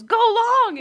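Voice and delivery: high, loud retold call. Stepdad throwing a ball in Target, calling out. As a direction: mimic someone calling a long pass.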